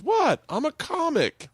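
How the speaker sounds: high, slow mock-innocent protest; defensive protest. Retelling a comic facing a shocked crowd, defensive. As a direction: plead innocence in an imitated high voice.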